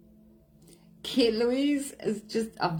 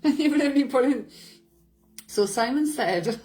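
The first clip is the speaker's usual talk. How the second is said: fast, much higher and louder through laughter; laughing; 6 semitones higher, 5 dB louder than usual. Real, not acted. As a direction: blurt it out mid-laugh. Laughing while retelling a chat.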